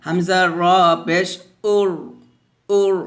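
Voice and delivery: thick, rounded r in উর; উর with a dark rolled ending; heavy r. Shown as a demonstration. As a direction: fill the mouth on the র of উর. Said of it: "পেশ থাকলে র সাকিন মোটা হয়".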